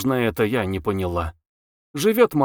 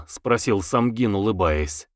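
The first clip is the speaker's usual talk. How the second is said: plain reading voice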